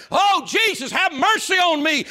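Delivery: high, loud cry for mercy; calling out. Acted. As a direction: cry out to Jesus at full voice. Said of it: "you call out and say".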